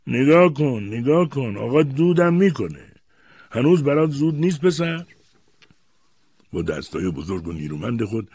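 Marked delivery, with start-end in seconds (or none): 0.1-5.1 high, loud jeering; derisive. A narrator's reading. Grandfather ridicules the boy for lighting a cigarette, mocking. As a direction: make a loud mockery of him. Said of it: "پدر بزرگ به مسخره گفت".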